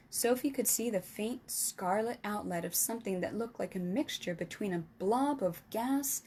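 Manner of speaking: usual speaking voice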